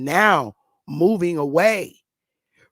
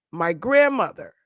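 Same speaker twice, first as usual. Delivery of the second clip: ordinary talk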